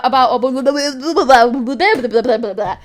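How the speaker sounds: babbling gibberish like a Tasmanian devil. Real, not acted. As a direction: splutter into frantic nonsense syllables. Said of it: "Tasmanian devil over here".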